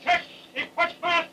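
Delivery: high, slow called-out orders; shouted commands. Real, not acted. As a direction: call each command down the line, slow. Jump master giving jump commands.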